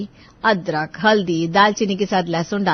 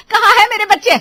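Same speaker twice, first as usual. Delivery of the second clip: high, loud furious demand; an enraged mother demanding answers; भड़की हुई; 10 semitones higher, 9 dB louder than usual. Acted. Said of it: "Poonam और विमला सौम्या पर भड़क रही थी". (ग़ुस्से में चीखती माँ)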